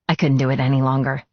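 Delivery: plain reading voice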